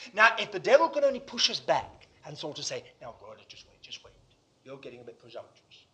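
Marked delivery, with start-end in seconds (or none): 3.0-5.9 low, very soft cautioning voice; insinuating tempter. Acted. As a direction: quietly talk a believer down. Devil quietly holds believer back.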